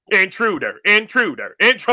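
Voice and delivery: robotic intruder warning escalating; car alarm voice. Retold or imitated. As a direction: repeat it like a rising machine alert. Voicing the car's alarm.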